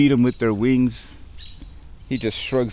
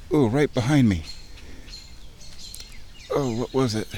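usual speaking voice

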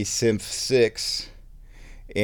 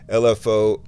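normal talking voice

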